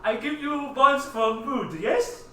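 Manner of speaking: high, slow exaggerated Chinese accent. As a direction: crude Chinese shopkeeper accent. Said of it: "do my racist Chinese accent for the chef".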